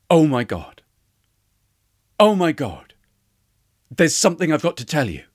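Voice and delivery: loud, startled outburst with long pauses; startled, urgent storytelling voice; stunned realisation. A narrator's reading. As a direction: let the shock land, then rush the confession. Retold sudden realisation after crying.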